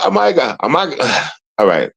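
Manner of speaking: ordinary talk, nothing marked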